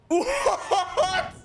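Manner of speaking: high-pitched laughing what; laughing disbelief. Real, not acted. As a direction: squeak it out through a laugh. Laughing disbelief at game loss.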